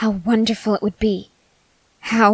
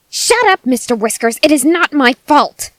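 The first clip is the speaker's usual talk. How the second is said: sudden much higher, louder outburst; yelling at the cat; 5 semitones higher, 8 dB louder than usual. Acted. Cultist snapping at her cat, shrill outburst. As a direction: explode at Mister Whiskers.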